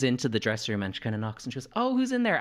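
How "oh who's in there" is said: high call through the door; higher-pitched retold question; inquiring colleague. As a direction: imitate someone calling in. Voices colleague knocking on door.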